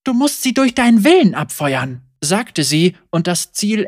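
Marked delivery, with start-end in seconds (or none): 0.0-2.0 higher, louder, stern instruction; firm Senna. A narrator's reading. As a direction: teach it with hard focus. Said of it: "Ihre Gesichtszüge verhärteten sich".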